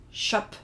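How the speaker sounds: short 'shup' as an English speaker's try at Dutch; Dutch word attempt. Shown as a demonstration. Host trying the Dutch word for sheep, short 'shup'. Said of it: "make an English person say".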